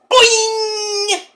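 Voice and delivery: high, slow, stretched-out cartoon boing; drawn-out comic boing; boing sound effect. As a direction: bounce the word like a spring. Imitating a bounce off the lumbar check.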